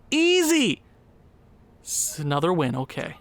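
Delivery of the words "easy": smug. Real, not acted. Repeats easy with mock bravado.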